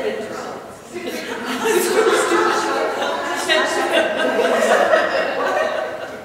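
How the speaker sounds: loud, breathy drawn-out real laughter; can't stop laughing; long laughing fit. Real, not acted.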